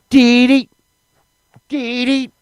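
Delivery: high-pitched "dee dee" chirp; strong man noise. Retold or imitated. Comic imitating the circus strong man, high chirped noise.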